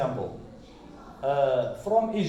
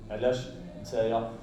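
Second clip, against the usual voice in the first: fast Moroccan Darija with علاش; 8 semitones lower, 5 dB quieter, much faster than usual. Shown as a demonstration. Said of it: "how people say this".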